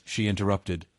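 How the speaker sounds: ordinary delivery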